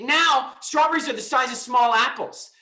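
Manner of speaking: high-pitched, loud emphasis on the contrast; emphatic exclamation. Real, not acted. Emphatic contrast with strawberries today.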